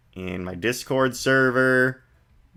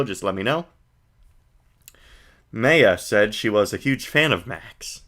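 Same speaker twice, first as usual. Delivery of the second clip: ordinary talk, nothing marked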